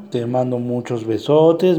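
usual speaking voice

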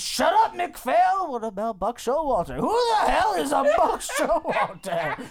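high, loud dismissive holler; bellowing boss. Acted. Boss cutting off McPhail over Buck Showalter, bellowing. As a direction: shout the underling down, incredulous. Boss yelling at his underling.

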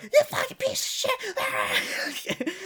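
very high, drawn-out mock scream; imitated screaming. Girl cursing him over the speakerphone, imitated screaming. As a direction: screech the insult in falsetto. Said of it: "she's reaming me out".

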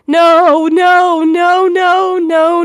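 loud, high mock-anguished repeats; wailed protest. Reading own chat messages to friends, mock wail. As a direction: wail it out in hammed-up despair.

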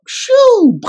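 sudden, very high "Shoo!" whoosh; whoosh sound. Retold or imitated. Fainting at the clinic, high whoosh. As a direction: make the sound of blacking out.

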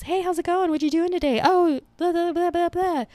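high, overexcited small talk; mock a barista fawning over everything; gushing barista. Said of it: "some of these other Dutch Bros".

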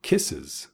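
"Kisses" as KISS-iz, ending in a buzz; added IZ ending. Shown as a demonstration. Teacher modelling the plural for learners, iz ending in "Kisses". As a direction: add a low, unstressed iz to "Kisses". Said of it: "We add is to the end".

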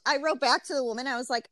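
ordinary talk

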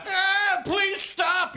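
high, pleading cartoon yelp; hammed-up high-pitched yell; yelling Bill Cosby. A narrator's reading. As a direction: beg in a squealed mock panic. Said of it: "yelled Cosby".

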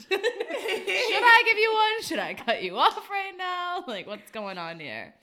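high, slow, laughing mock concern; mock bartender. Bartender asking a crying customer if she should cut her off, laughing and slow. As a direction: pretend to fuss over a weepy drunk. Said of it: "bartender to crying customer".

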